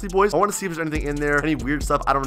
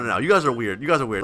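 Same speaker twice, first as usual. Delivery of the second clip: ordinary talk, nothing marked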